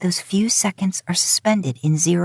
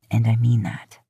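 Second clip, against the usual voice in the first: sincere Dean voice; 6 semitones lower than usual. Acted. Dean reassures her.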